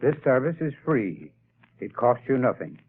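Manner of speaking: ordinary talk, nothing marked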